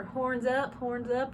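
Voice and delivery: Southern drawl on a band command; Southern accent. Shown as a demonstration. Coach shows what drum majors should avoid, Southern accent. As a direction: stretch the vowels with a hammed-up Southern accent. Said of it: "Southern accent needs to go out".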